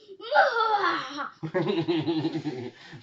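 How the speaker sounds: high loud villain cackle; evil laugh. Pretend. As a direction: throw back your head and cackle like a wicked wizard. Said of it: "The evil laugh is this".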